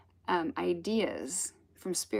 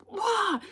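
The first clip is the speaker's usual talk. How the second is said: startled yelp; 4 dB louder than usual. Retold or imitated. Startled by cold wave on beach.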